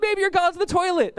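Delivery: very high, mocking call-out; taunting. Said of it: "He's just calling them out".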